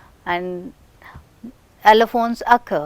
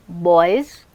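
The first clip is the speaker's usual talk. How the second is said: ordinary talk, nothing marked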